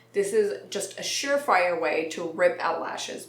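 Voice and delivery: ordinary talk, nothing marked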